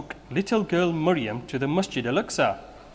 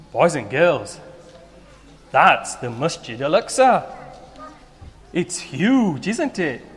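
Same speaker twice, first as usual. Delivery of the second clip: put-on kids show host; 4 dB louder than usual. Host talks to children.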